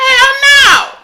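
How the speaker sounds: high, loud outraged cry; shocked hell no. Retold or imitated. Reacting to the killer, high outraged shout. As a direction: shriek it in disbelief. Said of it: "I said".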